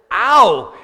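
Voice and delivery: loud high yelp at the calipers. Retold or imitated. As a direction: yelp it in mock pain. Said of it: "And I, I was like".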